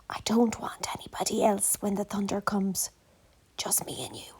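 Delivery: soft unvoiced whisper. A narrator's reading. Little Goosey whispering to Gander Goose, hushed whisper. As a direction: breathe it like a secret. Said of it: "Little Goosey whispered to Gander Goose".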